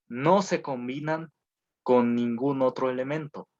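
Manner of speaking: dictado lento. Shown as a demonstration. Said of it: "entonces pónganle gases nobles".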